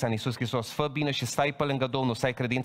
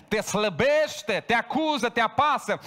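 rising preaching; 9 semitones higher, 4 dB louder, slower than usual. Acted. Preacher describing Satan's pressure.

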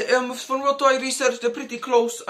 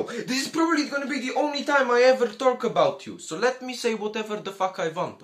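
ordinary delivery

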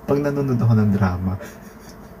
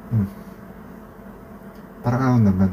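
ordinary delivery

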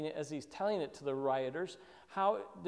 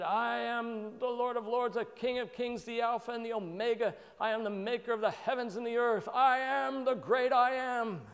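majestic Lord proclaiming; 7 semitones higher, 4 dB louder than usual. Retold or imitated. Preacher voicing the Lord as he could have spoken, grand and proclaiming. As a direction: boom it out like a king enthroned. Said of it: "He could have said".

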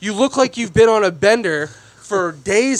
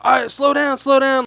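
jokey mock warning, pitched up; joking around; 7 semitones higher than usual. Dad joking around with his kid, playful and high. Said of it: "joking around".

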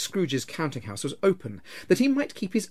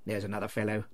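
low, soft grumble; muttered; 4 semitones lower, 5 dB quieter, faster than usual. A narrator's reading. Scrooge overhearing his cordial clerk, muttering. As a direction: grumble it under your breath. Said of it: "muttered Scrooge".

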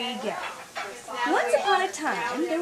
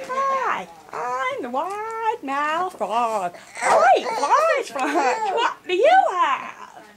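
frog voice; 6 semitones higher, 5 dB louder, slower than usual. Acted. Frog greets the giraffe.

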